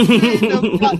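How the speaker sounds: can't hold it in, laugh the words out; laughing through it. Real, not acted. Retelling the funniest scene to a friend, laughing. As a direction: quote cracking into real laughter.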